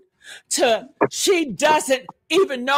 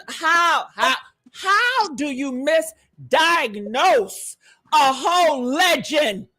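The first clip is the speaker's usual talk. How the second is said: ordinary delivery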